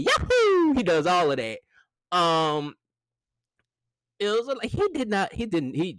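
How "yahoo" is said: high, louder, slow Mario "yahoo!". Retold or imitated. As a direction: stretch it out like a Mario jump. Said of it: "the little intangibles that Mario does".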